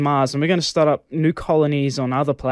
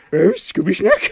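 high, slow cartoon dog voice with a laugh; Scooby-Doo voice; 12 semitones higher than usual. Joking with friends, a high, slow cartoon dog voice.

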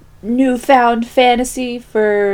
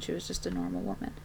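ordinary talk, nothing marked

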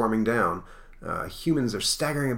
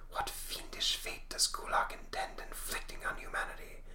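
hushed, ominous spectral whisper; very soft, voiceless whisper; ghostly whisper; 12 dB quieter than usual. Acted. Spectre wonders about the threat.

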